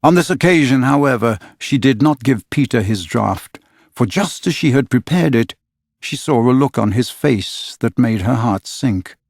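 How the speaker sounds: ordinary delivery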